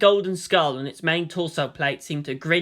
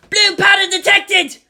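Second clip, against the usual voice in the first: high, loud alarmed yell; scream; 9 dB louder than usual. Acted. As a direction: shout the alarm in panic. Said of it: "Gamma Lead's gunner screamed".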